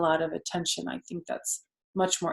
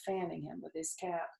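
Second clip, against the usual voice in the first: usual speaking voice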